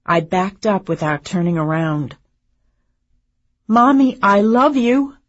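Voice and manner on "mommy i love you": loud, high, heartfelt call. A narrator's reading. Daughter at the door calls back to mother, calling out. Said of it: "I called out".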